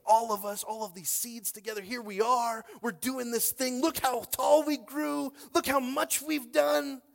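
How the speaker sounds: high, loud, slowing at the peak; rising excitement. Acted. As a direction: build up to a triumphant cry. Preaching at full force.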